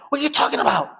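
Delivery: very high incredulous question; alarmed protest. Retold or imitated. Friends reacting to talk of beating cops, high alarmed protest. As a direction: squeal it in retold disbelief.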